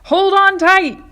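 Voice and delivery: high, loud call to brace; warning shout. Acted. Grasshopper warning everyone as the peach moves, loud high shout. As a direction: yell it over the lurch. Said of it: "shouted the old green grasshopper".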